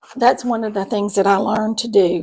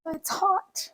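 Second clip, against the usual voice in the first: high, much softer pained aside; lingering ouch, pitched up and soft; still stinging; 8 semitones higher, 7 dB quieter than usual. Real, not acted. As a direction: wince it out under your breath. Repeats after the burn.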